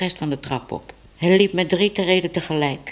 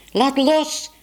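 put-on child's yell; 10 semitones higher than usual. A narrator's reading. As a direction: yell it high like a girl fighting over something. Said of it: "gilde Bibi".

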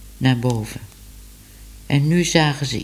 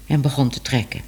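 ordinary delivery

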